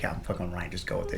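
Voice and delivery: low, hushed aside to a friend; conspiratorial aside. Retold or imitated. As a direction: let him in on the prank under your breath. Confiding a prank to friend.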